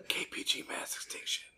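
breathy ASMR whisper. Pretend. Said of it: "Geology A S M R".

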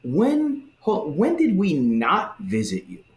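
high, slow, affronted protest; outraged self-righteous denial; indignant goats. Retold or imitated. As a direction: protest as if wrongly accused. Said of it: "they kinda say it like".

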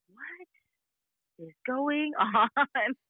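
high, hushed start, drawn-out ending; mock disbelief. Mom reacting to daughter's school trouble, drawn-out disbelief. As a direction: stretch it out in baffled disbelief.

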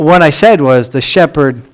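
ordinary talk

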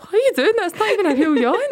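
high, disbelieving outburst; incredulous. Retold or imitated. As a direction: call out the fake in disbelief. Calling out a fake yawn.

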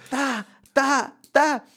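high, separate "ta" strikes; imitated hammer blows. Master builder hammering hard concrete, imitated knocking. Imita más golpes del mazo.

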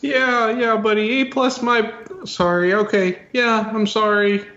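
high-voiced, flustered [name] stalling with ums; [name], nervous apologizer. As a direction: apologize awkwardly in a high falsetto. [name] answers ride operator.

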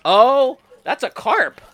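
high, loud surprised cry. Real, not acted. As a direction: blurt it out in surprise. Surprised exclamation at fish.

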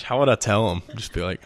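ordinary talk, nothing marked

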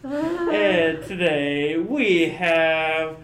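slow booming ringside intro; hype announcer. Pretend. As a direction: stretch the names like a fight announcer. Announcing guests like a rally.

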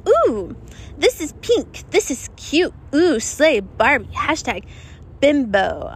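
lightly voiced valley girl gushing over cute clothes; girly bimbo voice. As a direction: play the giddy Barbie girl. Acted girly self in pink.

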